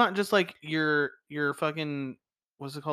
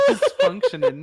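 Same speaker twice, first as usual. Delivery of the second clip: laughing squeal; 7 dB louder than usual. Real, not acted. Laughing while saying the word.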